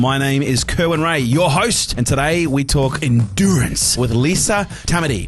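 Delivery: punchy, lifted announcer delivery; podcast host introduction. Acted. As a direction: introduce the guest like a big event.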